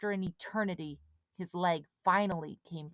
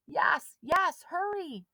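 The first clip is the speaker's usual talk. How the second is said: urgent; 11 semitones higher, 5 dB louder, slower than usual. A narrator's reading. Rescue in the water.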